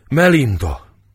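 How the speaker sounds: loud, high cry of the name. A narrator's reading. Izidóra cries out at the sight of the dead, loud. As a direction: call her name in shock.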